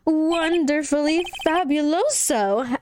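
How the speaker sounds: high, slow and showy; flamboyant showman voice. Acted. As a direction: savor the word like a diva. Max character line.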